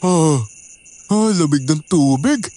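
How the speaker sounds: put-on slow sloppy drunk muttering; too drunk to care; blackout drunk. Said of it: "lasing na lasing ka".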